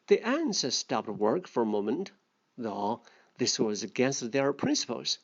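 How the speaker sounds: a lightly voiced storybook reading; story reading. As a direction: read the fable aloud to the class. Second read of the fable.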